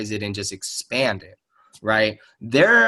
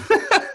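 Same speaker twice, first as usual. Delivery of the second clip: short high laugh. Real, not acted. Laughs after his claim.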